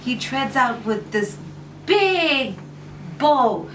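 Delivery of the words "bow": plain reading voice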